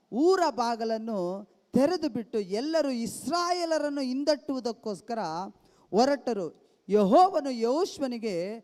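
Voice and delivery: loud, slow Bible reading; scripture reading. Acted. Preacher reading Joshua to the church, loud and slow. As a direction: declaim the verses to the hall.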